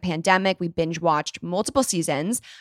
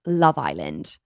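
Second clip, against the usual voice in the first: playful attempt at a British accent; lightly put-on British accent, pitched a bit lower; 10 semitones lower, slower than usual. Host showing off her Love Island obsession, British accent. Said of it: "Was that a good accent?".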